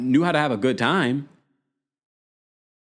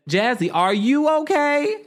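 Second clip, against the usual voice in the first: high, louder worried call of concern; a friend checking on a castmate, called up high and louder; concerned Gigi; 13 semitones higher, 4 dB louder than usual. Retold or imitated. Mock concern. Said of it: "Missus Gigi says".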